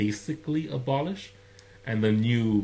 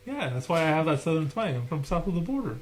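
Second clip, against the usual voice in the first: southern twang; 7 semitones higher than usual. Pretend. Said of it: "you had a Louisiana twang".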